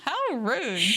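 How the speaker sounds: high mock outrage; mock indignant. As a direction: play scandalized.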